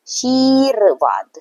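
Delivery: शीर्वाद very slowly as sheer-vaad, r held after shee; drawn-out syllables. Shown as a demonstration. Teacher showing children where the r falls, drawn out. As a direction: stretch शीर्वाद to expose the r after the sh. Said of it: "श के बाद रॉ आ रहा है".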